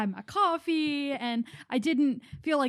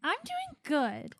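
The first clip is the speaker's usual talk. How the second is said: mock soothing; 4 semitones higher, 5 dB quieter, much slower than usual.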